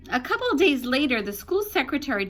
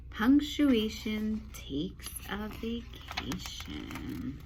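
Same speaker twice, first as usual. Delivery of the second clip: slow, drawn-out title announcement; slow storybook title read; 6 dB quieter, slower than usual. Shown as a demonstration. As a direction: announce the title slowly and grandly. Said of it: "Here we go".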